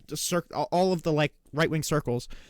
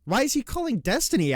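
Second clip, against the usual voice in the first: much higher, disbelieving; incredulous outburst; 8 semitones higher than usual. Real, not acted. Streamer calling out Piers Morgan, high incredulous outburst. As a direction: sound floored by the hypocrisy.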